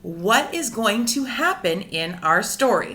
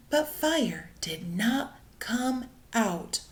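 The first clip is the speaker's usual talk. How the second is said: slow, hushed whisper for suspense; 2 semitones lower, 8 dB quieter, slower than usual. A narrator's reading. Narrator to class, whispering the letdown slowly. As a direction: draw the listeners in with a whisper. Dramatic story moment.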